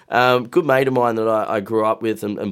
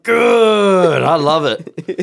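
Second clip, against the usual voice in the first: sarcastic praise; 6 semitones higher, 7 dB louder, much slower than usual. Acted. Sarcastic praise of bottle collectors.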